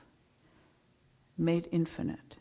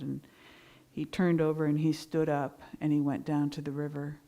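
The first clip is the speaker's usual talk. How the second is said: ordinary delivery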